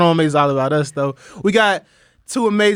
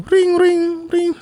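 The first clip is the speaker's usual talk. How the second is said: high mock ringtone; imitated phone ring; 12 semitones higher, much slower than usual. Host starts the role play by voicing the phone, high imitated ring. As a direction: sing out a ringing phone, high. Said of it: "the phone's ringing".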